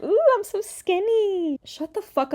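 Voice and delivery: high, drawn-out mock singer voice; mocking imitation. Commentator parodying the singer's skinny boast, high mocking coo. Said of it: "She's like".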